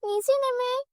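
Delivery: put-on high girlish voice, eager and fretting; a young girl straining to reach something; young girl Kabita. Young girl's voice, dream.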